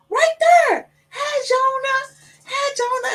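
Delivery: put-on high, slow child voice waving to a ghost; little boy calling. Boy greets his dead uncle, high child voice. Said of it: "And Rain was like".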